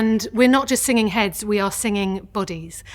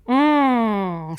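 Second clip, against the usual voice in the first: humming mm. Shown as a demonstration. A singing teacher shows the class a hum. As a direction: hum through closed lips. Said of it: "you're left with".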